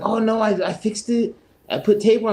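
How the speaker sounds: a hint of a defensive kid; retold insistent coworker.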